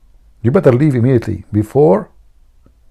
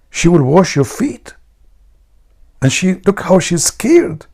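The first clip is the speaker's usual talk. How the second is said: high, thin incredulous voice; mock astonishment; 8 semitones higher than usual. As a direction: marvel at it in a put-on high voice. Astonished friend reacting in joke.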